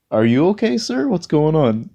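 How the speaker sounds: high, loud, sing-song fake concern; mock concern. Walker mocking a driver by asking back, fake concern. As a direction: turn his question back on him sweetly.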